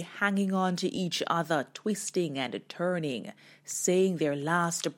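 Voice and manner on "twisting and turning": usual speaking voice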